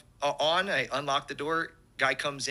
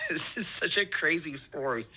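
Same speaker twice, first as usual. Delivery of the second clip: laughing; 3 semitones higher than usual. Real, not acted. Laughing at his own story.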